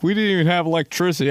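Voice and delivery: high, fast whiny mock of privileged people; mocking complainer. Mocking people who claim hardship, whiny high voice. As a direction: sneer it like a spoiled complainer. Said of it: "Some people are like".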